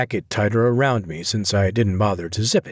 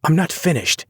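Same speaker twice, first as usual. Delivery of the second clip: high, tight clenched-teeth anger; through clenched teeth; 7 semitones higher than usual. A narrator's reading. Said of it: "her teeth clenched".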